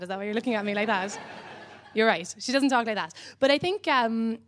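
normal talking voice

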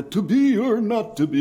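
high-pitched mock English thespian; cod English accent. Mocking American actors doing Shakespeare, cod English accent. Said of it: "that kind of cod English thing".

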